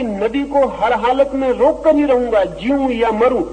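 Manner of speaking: high-pitched mock vow, half shouted; retold defiant shout. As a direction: play the straw vowing to fight to the death. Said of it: "वो चिल्ला रहा है".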